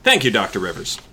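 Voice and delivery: low-pitched expert addressing the panel; doctor colleague. Acted. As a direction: drop low for the visiting doctor. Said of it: "your voice sounds too much like the others".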